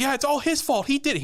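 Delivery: high-pitched frantic accusation; panicked blaming. Retold or imitated. Aunt shifting blame onto her husband, panicked. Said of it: "starts trying to beat him up".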